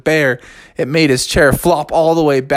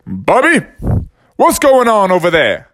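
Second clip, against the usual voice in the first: coach calling; 6 semitones higher than usual. Acted. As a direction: shout to the player. Coach calls across field.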